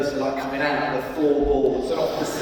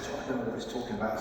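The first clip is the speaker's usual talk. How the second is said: much softer, fast aside; hushed aside; 4 semitones lower, 11 dB quieter, much faster than usual. Retold or imitated. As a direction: mutter quickly to George.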